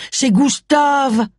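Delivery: Gustave drawn out very slow and high, falling at the end; high, slow surprised exclamation; excited cry. A narrator's reading. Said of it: "s'écria Charles".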